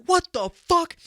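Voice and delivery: high, loud mock shock; a punched girl jumping up furious; stunned yell. Girl who got clocked jumps up, shocked yell. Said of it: "she'll get up and be like".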